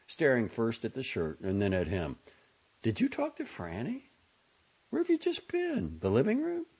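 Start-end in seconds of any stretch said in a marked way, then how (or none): 2.8-6.7 very high motherly interrogation; high-pitched inquisitive mother; questioning mother. A narrator's reading. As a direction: press him with high, rising questions. Mother questions son about Franny.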